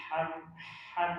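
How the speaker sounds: ordinary talk, nothing marked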